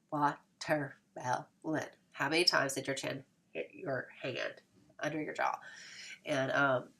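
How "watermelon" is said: watermelon said very slowly, jaw dropping on each syllable; exaggerated. Shown as a demonstration. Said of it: "you say it exaggeratedly".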